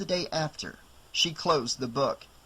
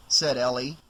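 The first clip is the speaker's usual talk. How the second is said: usual speaking voice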